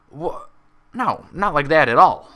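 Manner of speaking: loud, slow, flustered protest; exclaiming. Acted. Applejack stopping Pinkie from treasure hunting, loud and slow. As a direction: cut her off firmly, slowly. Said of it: "Applejack exclaimed".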